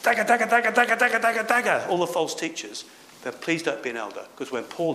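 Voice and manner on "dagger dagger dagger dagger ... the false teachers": much higher, louder, gleeful stabbing chant; mock heresy hunter. Preacher mocking young men who love denouncing, shrill mock chant. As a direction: lampoon the eager denouncer stabbing away. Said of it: "if you love denouncing everybody".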